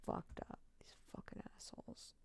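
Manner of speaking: hissed whisper; whispered fury at the police; angry whisper. Real, not acted. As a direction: whisper the curse with contempt. Angry whispered curse at cops.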